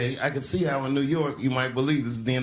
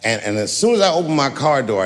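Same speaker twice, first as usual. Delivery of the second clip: usual speaking voice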